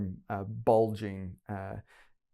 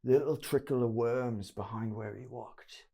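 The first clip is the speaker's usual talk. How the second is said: higher-pitched rough-grammar thug voice; thug confessing; 4 semitones higher, 3 dB louder than usual. Acted. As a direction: tell what you saw, still rattled.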